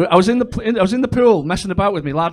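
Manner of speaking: ordinary delivery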